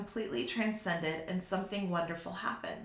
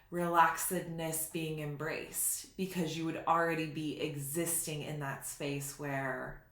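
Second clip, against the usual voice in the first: ordinary talk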